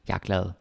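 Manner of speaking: jeg reduced to ja, quick and soft. Shown as a demonstration. Teacher showing learners the everyday ja for jeg. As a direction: say jeg lazily as ja. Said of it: "jeg is often pronounced as ja".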